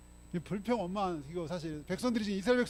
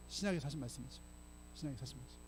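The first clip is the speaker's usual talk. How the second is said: ordinary talk, nothing marked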